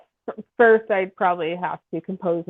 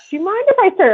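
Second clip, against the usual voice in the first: sweet asking voice; 13 semitones higher, 5 dB louder, much faster than usual. Pretend. Imagined polite request.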